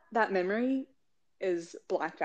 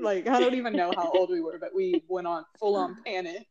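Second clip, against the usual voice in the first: normal talking voice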